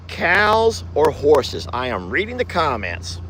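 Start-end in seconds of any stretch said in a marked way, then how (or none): 0.1-1.7 each word stretched long; slow, drawn-out question. Real, not acted. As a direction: drag the question out slowly. Repeating the question slowly.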